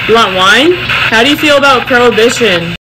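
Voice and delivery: loud calls to a ghost; calling out loud. Real, not acted. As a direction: demand answers from the spirit. Loud questioning of spirit.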